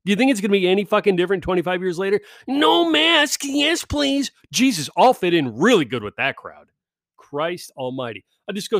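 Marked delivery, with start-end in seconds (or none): 2.5-4.3 high mocking chant of the invite; higher mock-cheerful reading; mocking invite line. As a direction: sneer it much higher. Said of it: "the first line".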